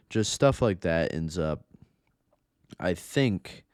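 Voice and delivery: usual speaking voice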